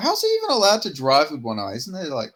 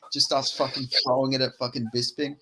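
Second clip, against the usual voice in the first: normal talking voice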